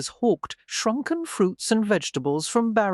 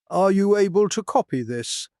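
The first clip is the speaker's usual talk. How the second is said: curt, louder question in a put-on voice; brusque; 3 semitones higher, 5 dB louder than usual. A narrator's reading. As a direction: ask it bluntly, no courtesy. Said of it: "he asked brusquely".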